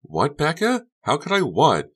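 high, loud, baffled questions; bewildered. A narrator's reading. Honey to a screaming Becca, bewildered. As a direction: ask in utter confusion.

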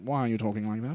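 mock British accent, quietly baffled. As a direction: ask it in a soft put-on British accent. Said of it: "get away from all the British shit".

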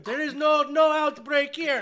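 put-on loud, slow, half-hearted Russian accent; a poor mock Russian accent, slow and loud; bad Russian accent. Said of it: "I don't think that was a Russian accent".

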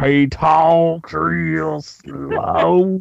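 very slow, drawn-out imitation of a friend; slow drawl impression. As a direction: drag every word out lazily. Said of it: "he did it at the best voice".

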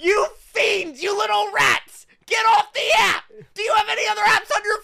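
high, loud shouting at the rats; mock outraged shout. Shouting at people hogging the app, high and loud. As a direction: yell it out in mock fury.

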